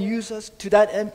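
usual speaking voice

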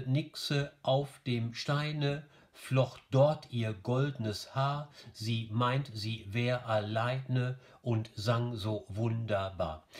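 slow, even beats through "NIXe AUF dem STEIne"; regular metrical scansion. Shown as a demonstration. Teacher showing the rest scans normally, slow scanning. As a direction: beat out "flocht DORT ihr GOLDnes HAAR" slowly. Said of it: "der Rest ist dann normal".

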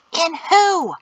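high, forceful hissed challenge; hissing. A narrator's reading. Son challenges his father's faith, hissing. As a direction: hiss it sharply, pitch jumping up. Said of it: "Peto hissed".

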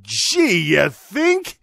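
dry mock-surprised "gee, you think"; sarcastic. Mocking the article's point with a sarcastic aside. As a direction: roll your eyes at the obvious. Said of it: "Oh, emphasis. "Gee, you think" mine".

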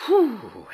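high, loud exhale of relief; relieved "whew". A narrator's reading. Niece hearing there are no mistakes, relieved. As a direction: let out a big phew. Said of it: "she looked relieved".

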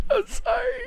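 high, laughing apology; real helpless laughter. Real, not acted. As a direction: apologize through a fit of laughter. Helpless laughter at poopy remark.

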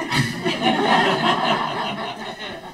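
high airy laugh; breathy laughter. Real, not acted. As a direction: laugh off the punchline. Laughs after punchline.

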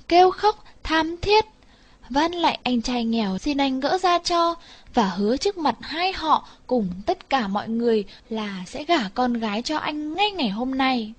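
plain reading voice

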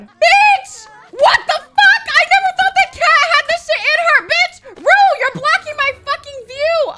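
very high, loud shrieking; shocked screaming. Real, not acted. Shocked at sudden in-game attack.

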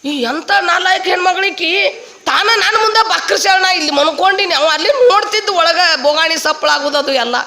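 high-pitched inner grumbling; comic indignation. Acted. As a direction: complain in outraged disbelief, playing it for laughs. Man playing dead grumbles about wife. (ಹಾಸ್ಯದ ಸಿಟ್ಟಿನ ಗೊಣಗಾಟ)

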